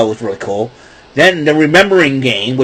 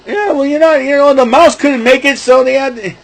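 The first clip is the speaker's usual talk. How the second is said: Muppet voice; 11 semitones higher than usual. Retold or imitated. Voicing Muppet reply in skit.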